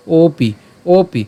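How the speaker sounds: ওপি drawn out slowly, starting on o; avagraha as vowel. Shown as a demonstration. Teacher explains the half-letter, ওপি slow with initial vowel. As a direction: stretch ওপি slowly, opening on a clear o. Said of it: "স্বরের মতো হবে".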